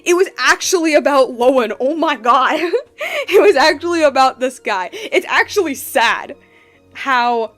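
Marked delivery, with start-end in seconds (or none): none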